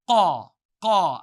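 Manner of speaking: "Qaw, qaw." loud from far back in the throat; deep qaf. Shown as a demonstration. Teacher demonstrates qaf for students. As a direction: click it deep behind the uvula. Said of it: "with your mouth, say".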